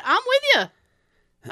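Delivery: high, drawn-out agreement; emphatic agreement. Retold or imitated. Narrator agrees with the old lady, high and slow. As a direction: stretch it out, fully on her side.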